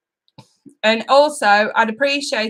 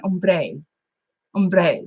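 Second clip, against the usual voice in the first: playful Spanish twang, rolled out in short takes; Spanish accent; 3 semitones lower than usual. Shown as a demonstration. Vlogger showing how ombre must be said, Spanish accent. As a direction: put on a sultry little Spanish accent. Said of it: "do it in a Spanish accent".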